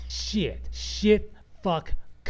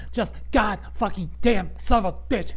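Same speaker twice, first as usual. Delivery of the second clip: louder, higher burst of swearing; angry cursing; 4 semitones higher, 5 dB louder than usual. Real, not acted. Podcaster who lost hours of work, angry cursing. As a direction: punch out each curse in real frustration.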